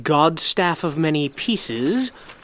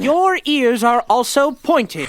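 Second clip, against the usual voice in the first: indignant retort; 6 semitones higher, 7 dB louder than usual. Acted.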